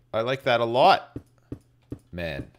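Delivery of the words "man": ordinary talk, nothing marked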